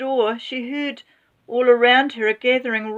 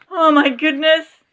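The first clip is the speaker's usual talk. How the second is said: high, loud oh my goodness; excited exclamation; 8 semitones higher, 6 dB louder than usual. A narrator's reading. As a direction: cry it out in alarm. Refrain, excited.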